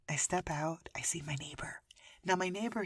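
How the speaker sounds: whispered storytelling. Real, not acted. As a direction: drop to a breathy whisper. Telling story in hushed tone.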